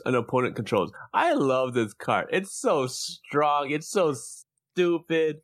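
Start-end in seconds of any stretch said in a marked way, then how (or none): 1.1-5.4 slow, drawn-out gushing. Real, not acted. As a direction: stretch out "so" with relish. Gushing over a favourite card.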